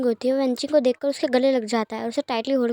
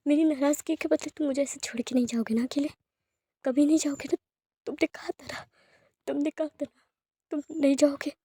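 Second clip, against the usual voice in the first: quiet crying plea, voice raised in pitch; weeping; 2 semitones higher, 4 dB quieter than usual. Acted. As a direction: beg through tears not to be left. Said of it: "बहुत तेज-तेज रोने लगता है".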